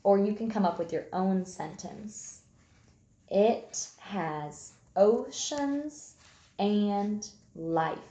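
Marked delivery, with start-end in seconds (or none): 3.3-8.0 very slow, each word drawn out for copying; slow dictation. Shown as a demonstration. Teacher dictating her sentence to young writers, very slow. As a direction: read it slowly so children can copy. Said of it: "You can copy my sentence".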